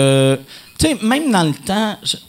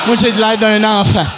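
laughing; 3 semitones higher, 5 dB louder than usual. Real, not acted. Laughing self-mockery.

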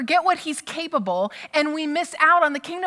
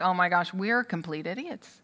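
soft, low embarrassed mumble; the ashamed disciples mumble to themselves; sheepish; 6 semitones lower, 7 dB quieter than usual. Retold or imitated. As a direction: disciples caught out, softly ashamed. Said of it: "now they're like".

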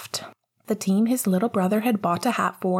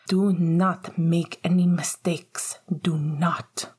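hissed warning; slower than usual. Acted. Mother warning her son under her breath, slow whispered hiss. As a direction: whisper it slowly like a cold command. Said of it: "his mother hissed at him".

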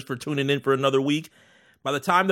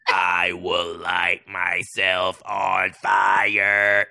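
dramatic vow; 7 semitones lower, much slower than usual. Acted. Joking threat, dragged out.

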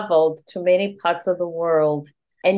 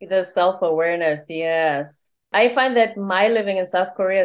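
ordinary talk

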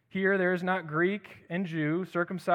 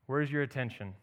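usual speaking voice

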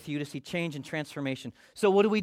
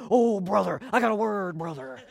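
mock churchy "brother" voice, louder and put-on; churchy insider talk; 5 dB louder than usual. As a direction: ham up the insider lingo a little. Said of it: "your cubicle mate is not gonna understand".

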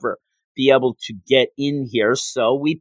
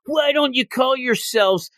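retold yell; 8 semitones higher than usual. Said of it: "And he yells".

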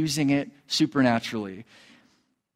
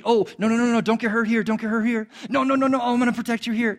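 fussy, fretting parent voice pitched up; mock helicopter father; 6 semitones higher, faster than usual. Preacher mocking an overprotective God, fretting high. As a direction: hover and fuss like an anxious dad. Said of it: "this helicopter father".

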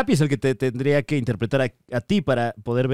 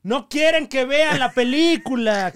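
mock outrage; 8 semitones higher, slower than usual. Mocking the conspiracy claim.

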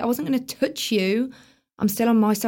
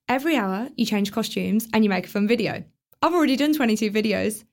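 ordinary talk, nothing marked

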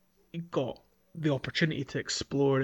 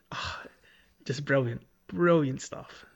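normal talking voice

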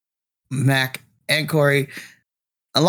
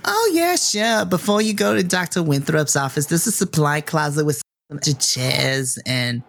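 put-on sweet old receptionist. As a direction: coo at them like a doting grandma. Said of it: "Wendy's just like".

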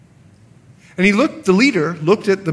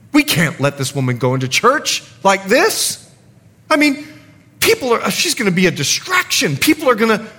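indignant; 5 semitones higher than usual. Retold or imitated. Pastor voiced in a story.

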